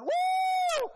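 very high, loud comic whoop; startled donkey shriek, hammed up. Preacher plays donkey seeing sword drawn, startled whoop. Donkey sees angel in road.

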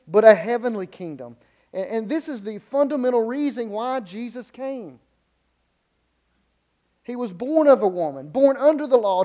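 loud, high fervent preaching; rising pulpit fervour on the kingdom; preaching at full force. Acted. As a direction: build it like a pulpit climax. Rising sermon climax.

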